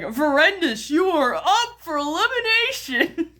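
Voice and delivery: high-pitched mock elimination call; mock announcement. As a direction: announce a contestant's doom, playfully overdone. Imagined YouTube announcing a punishment.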